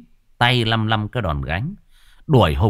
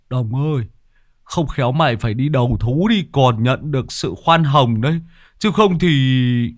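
mock warning call, voice pitched up; giọng nhân vật dọa; 4 semitones higher, 3 dB louder than usual. Lâm Sẹo gọi dọa Lão Đồng. (giọng Lâm Sẹo dọa nhẹ)

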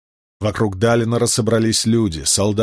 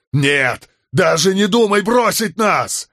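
high, rising yell; desperate defiant shout; 10 semitones higher, 4 dB louder, slower than usual. A narrator's reading. Man forbids the god to leave, defiant shout.

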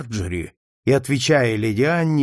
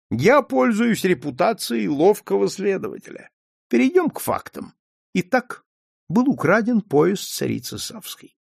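higher, measured sleuth laying out facts; self-assured detective; 7 semitones higher than usual. A narrator's reading. Detective lays out the theft facts, confident and measured. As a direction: present the case like a clever investigator.

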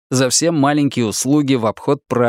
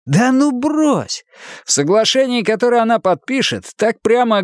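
character voice; 10 semitones higher than usual. Acted. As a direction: brush it off as Hardwick. Хардвик возражает собеседнику. (голос персонажа)

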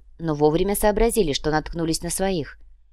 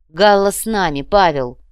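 loud, firm, commanding declaration; authoritative shout that drops on the last word; loud insistence; 8 dB louder than usual. A narrator's reading. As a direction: drive the point home forcefully. Insistent loud repeat after the demonstration.

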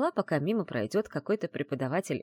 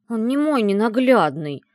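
loud, higher, cross denial; growled protest; 7 semitones higher, 11 dB louder than usual. A narrator's reading. Heroine rejecting her friend's teasing, angry growl. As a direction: growl it out indignantly. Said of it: "прорычала я".

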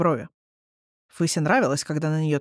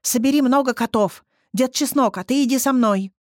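eager commands; 5 semitones higher, 3 dB louder than usual. Acted. Fysya commands the cats.

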